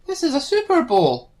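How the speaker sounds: high, loud disbelief; alarmed and shrill with shock. Pretend. Shocked at strange world.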